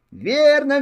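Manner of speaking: high, eager cry of agreement; excited shout. Acted. Hares cheer the old hare's guess, excited. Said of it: "закричали зайцы".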